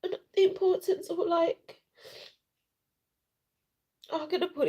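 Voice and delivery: high voice breaking into tears; rushed, choked-up crying; real tears cracking the voice. Real, not acted. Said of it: "I was crying".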